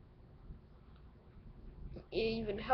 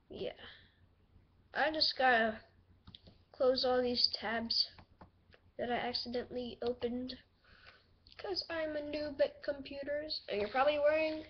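ordinary delivery

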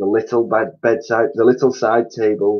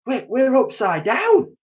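high, slow horrified gasp; 3 dB louder than usual. A narrator's reading. Mister Twit realising they are upside down, high horrified gasp. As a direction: gasp out in disbelief. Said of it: "gasped Mister Twit".

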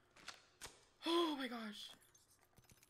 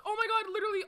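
normal talking voice

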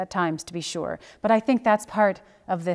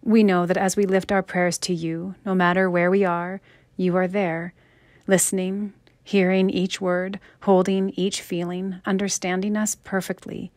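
earnest spoken prayer, slightly raised voice; 4 dB louder than usual. Acted. Pastor leading the congregation in closing prayer, earnest and steady. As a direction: pray it steadily over the congregation.

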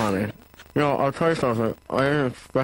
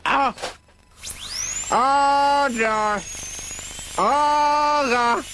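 screaming in pain; much slower than usual. Acted. Screaming through dental procedure.

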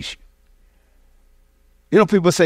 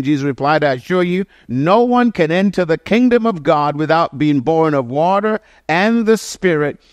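usual speaking voice

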